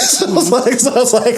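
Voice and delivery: real laughter breaking into speech; laughing. Real, not acted. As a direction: giggle out the lead-in. Laughing while recounting.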